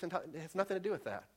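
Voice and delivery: ordinary talk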